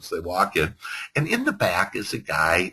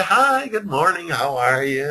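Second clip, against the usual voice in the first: cheery greeting; 9 semitones higher than usual. Retold or imitated. Agents greet lady from car.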